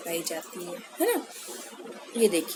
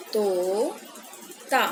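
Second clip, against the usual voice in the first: drawn-out reading; much slower than usual. Shown as a demonstration. Teacher modelling तोता for children, drawn out. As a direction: stretch तोता out very slowly, syllable by syllable. Teacher reads word slowly for kids.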